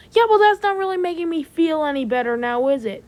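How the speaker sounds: exasperated sarcasm at a friend; irritated snap. Acted. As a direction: throw it back fast and annoyed. Snapping back at a friend's comfort.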